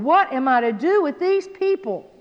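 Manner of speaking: high, exasperated plea to God; crying out. Acted. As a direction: cry out in acted frustration. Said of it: "cried out to the Lord".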